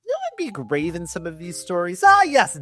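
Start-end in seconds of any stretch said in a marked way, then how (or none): 2.1-2.6 sarcastic. Acted. Mock answer to own question.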